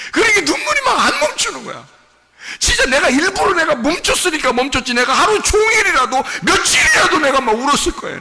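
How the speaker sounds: high, loud and crying as he preaches; sobbing shout. Real, not acted. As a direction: shout through real sobs. Preacher sobs while shouting. (흐느끼며 외치는 설교)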